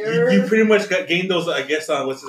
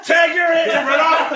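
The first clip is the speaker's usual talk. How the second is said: high, loud, slow laughing yell; laughing shout; 11 semitones higher, 6 dB louder, slower than usual. Real, not acted. Joking shout amid laughter.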